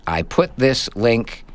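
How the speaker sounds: normal talking voice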